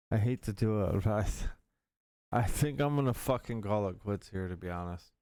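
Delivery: ordinary talk, nothing marked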